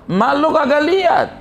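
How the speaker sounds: high, stretched incredulous question; incredulous challenge. Acted. Robber king challenging the boy, high and incredulous. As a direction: challenge them in a high acted voice.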